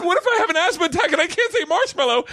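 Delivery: very high, laughing panic; squeaky, laughing worry; panicked thought. Retold or imitated. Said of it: "'Cause I'm like".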